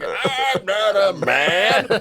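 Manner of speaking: hammed-up, high, loud protest; kids' mocking imitation. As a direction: drag out every word in mockery. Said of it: "pretending to do the walk of this person".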